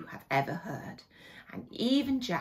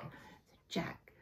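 plain reading voice

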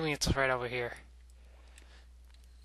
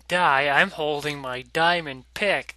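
excited; 7 dB louder, slower than usual. Real, not acted. Louder boast then laugh.

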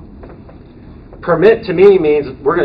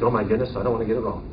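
mock anxious fret; 5 semitones lower, 8 dB quieter, faster than usual. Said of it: "be really anxious".

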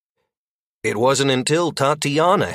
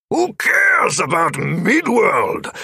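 bellowing; 8 semitones higher, slower than usual. A narrator's reading. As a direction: bellow it out with contempt. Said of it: "Oberon had bellowed".

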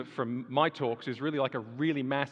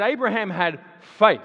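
quoting Peterson loudly; 7 semitones higher, 12 dB louder than usual. Retold or imitated. Quotes Jordan Peterson.

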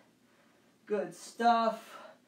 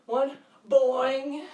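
bouncy, louder springy boing; kangaroo boing; 3 semitones higher, 7 dB louder than usual. Shown as a demonstration. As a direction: bounce the word like a kangaroo hop. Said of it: "Let me hear some kangaroo sounds".

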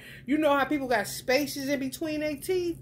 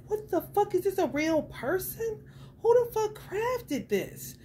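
amazed disbelief; 8 semitones higher than usual. Retold or imitated. Staring at the lifelike museum figure, high amazed disbelief. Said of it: "I'm like".

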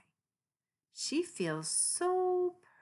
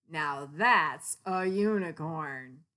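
slow, louder wonder; awed gecko; 7 dB louder than usual. Acted. Gecko points at the real unicorn, slow awed. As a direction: marvel at the sight.

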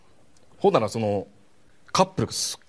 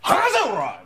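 higher, rough mock yell as the host guy; thug snapping; 11 semitones higher, much slower than usual. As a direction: shove her off with a yell. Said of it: "男が最後の". (ホスト風の男の怒声)

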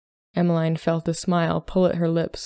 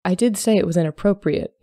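ordinary delivery

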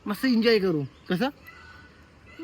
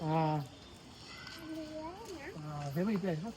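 hushed coaxing; 17 dB quieter than usual. Real, not acted. Coaxing someone close by, hushed and soft. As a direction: keep it down so you don't startle it. Quietly coaxing near the animal.

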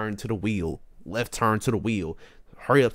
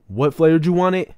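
loud, raised-pitch worker's call; mock yell over an intercom; imitated yelling; 5 semitones higher, 8 dB louder than usual. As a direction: call the question out loudly, imitating him. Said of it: "he was yelling".

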